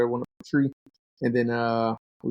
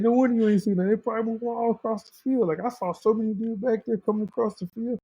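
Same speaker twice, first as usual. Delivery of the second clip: higher, lightly voiced buddy teasing; a hint of a laughing friend; buddy's voice; 10 semitones higher than usual. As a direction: pitch up as the friend explains. Buddy explaining in story.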